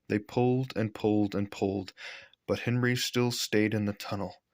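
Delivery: ordinary delivery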